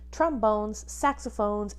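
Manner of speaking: ordinary talk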